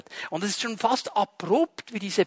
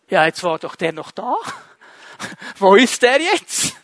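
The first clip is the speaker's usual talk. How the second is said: baffled Ethiopian; 4 dB louder than usual. Acted. The Ethiopian searching for Philip, amazed and chuckling. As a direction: wonder out loud where he has gone, half laughing. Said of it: "der Äthiopier".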